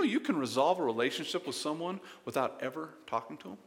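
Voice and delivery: ordinary talk, nothing marked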